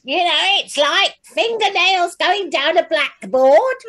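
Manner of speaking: high-pitched, loud, screechy fingernails-on-blackboard voice; put-on piercing, irritating narrator voice; grating character voice. Shown as a demonstration. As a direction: pitch it way up like nails scraping a blackboard. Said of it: "a tone of voice that is".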